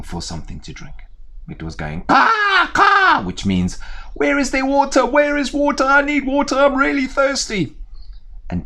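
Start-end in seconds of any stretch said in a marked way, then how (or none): 2.0-3.2 high loud cawing like a crow. Acted. Crow calls in fable. 4.1-7.8 high, loud pleading crow voice; a parched bird begging for water; thirsty crow. Acted. Thirsty crow speaks in fable.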